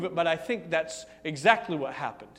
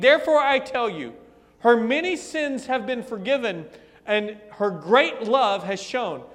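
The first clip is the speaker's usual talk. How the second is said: louder voicing of Jesus, pressing each line home; preaching at full force; 3 semitones higher, 5 dB louder than usual. Preacher voicing Jesus to Simon, forceful. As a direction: preach the rebuke with rising force.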